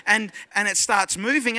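ordinary delivery

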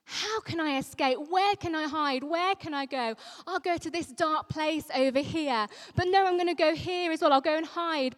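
David scrambling for a hiding place, rising and speeding up; fleeing David. Acted. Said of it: "David's first instinct is the same as ours".

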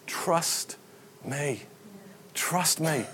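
hushed, almost whispered, with pauses; quiet reassurance. Acted. God answering people afraid to step in, hushed reassurance. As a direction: say it very softly and slowly.

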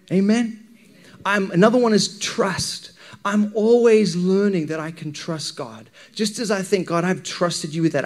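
ordinary delivery

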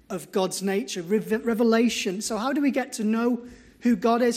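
ordinary talk, nothing marked

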